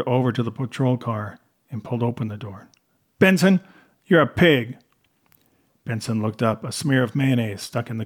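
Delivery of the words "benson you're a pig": loud, raised confrontation; sharp, loud disgust; angry insult. A narrator's reading. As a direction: storm up and tell him off. Nate storms over to berate Benson.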